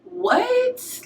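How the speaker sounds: high squeaked shock; a sudden high disbelieving what; squeaky stunned what. Retold or imitated. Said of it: "I'm looking like".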